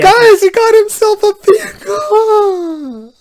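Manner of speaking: high, loud, slow sarcastic cry of joy; mock overjoyed. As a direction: gush like the happiest fan alive, clearly sarcastic. Mock joy at movie scene.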